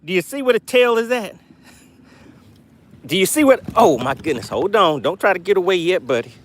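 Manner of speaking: normal talking voice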